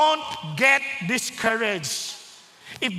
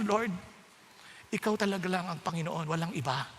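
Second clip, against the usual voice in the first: soft, lower prayer voice; humble prayer; 8 semitones lower, 6 dB quieter than usual. Acted. Believer surrendering to the Lord, soft prayer. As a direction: surrender it quietly to God.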